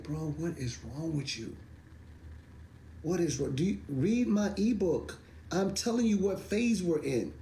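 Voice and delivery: high, disbelieving mock scolding; exasperated retort. Scolding doubters who skipped his ebook, high and incredulous. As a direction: pitch it up in fake outrage. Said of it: "I'm like".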